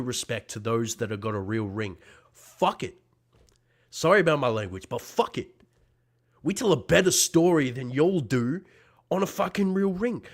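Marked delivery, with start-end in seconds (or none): 2.3-5.5 sharp loud curse; shouted fuck it; angry outburst. Real, not acted. Heated wrestling promo outburst. 6.4-10.2 loud, high challenge; defiant raised voice; heated boast. Real, not acted. Heated wrestling promo boast.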